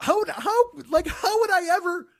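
high-pitched, halting retold protest; exasperated disbelief. Protesting to the ace about darts, exasperated. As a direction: stumble over it in incredulous high voice.

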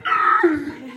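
sharp, high gasp of surprise. Real, not acted. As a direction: suck in air in shock. Shocked at Lincoln's sides.

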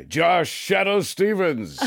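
slow showy radio-announcer impression; Shadow Stevens. Celebrity friend phones Josh, announcer impression. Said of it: "Shadow Stevens calls me all the time".

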